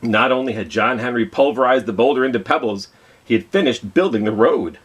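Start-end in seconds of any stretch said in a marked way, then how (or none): none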